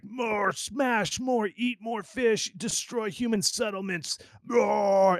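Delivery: high, slow dopey bear chanting demands; be a greedy bear listing its plans. Pretend. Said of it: "the whole thing is written like".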